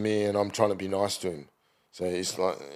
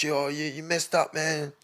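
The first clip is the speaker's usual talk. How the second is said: street hustler; 7 semitones higher, 3 dB quieter than usual. Retold or imitated. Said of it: "He goes".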